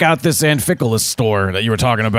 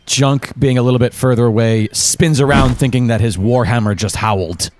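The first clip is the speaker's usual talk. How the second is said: ordinary delivery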